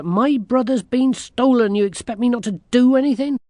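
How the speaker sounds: high, loud, quickening protest; indignant girl. A narrator's reading. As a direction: protest hotly as an outraged young girl. Girl protests at being told to wait.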